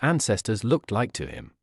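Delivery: usual speaking voice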